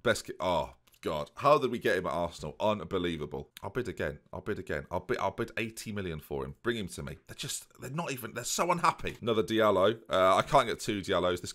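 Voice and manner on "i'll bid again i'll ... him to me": low, soft bidding; muttered bidding. Pretend. Manager bidding to himself for a player, low and soft. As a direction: keep it low, half to yourself.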